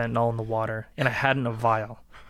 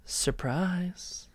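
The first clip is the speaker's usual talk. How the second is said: taunting; 5 semitones higher, 7 dB quieter than usual. Acted. Taunting reveal.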